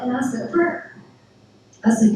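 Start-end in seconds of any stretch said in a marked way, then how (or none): none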